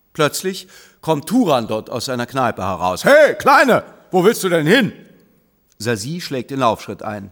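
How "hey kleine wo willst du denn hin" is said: brash man. Acted. Man accosts girl outside pub.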